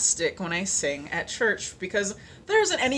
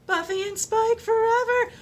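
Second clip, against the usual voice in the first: much higher, louder fan squeal; fangirl squeal; 4 dB louder than usual. Real, not acted. Fan cheering her favorite TV couple, high excited squeal.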